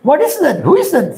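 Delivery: startled question. Retold or imitated. Startled question about loud noise.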